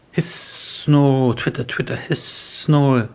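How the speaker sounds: hissing, snoring, twittering chick sounds; owl noises. Acted. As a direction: make the owlets' noises. Imitating baby owl noises.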